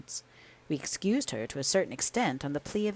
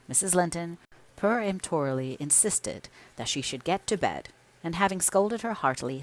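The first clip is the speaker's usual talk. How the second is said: ordinary delivery, nothing marked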